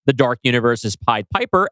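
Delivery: ordinary delivery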